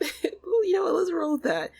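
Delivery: high, loud laughing reaction; amused outburst. Real, not acted. Player reacting to a name slip, laughing and loud. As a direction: laugh through it, pitched well up.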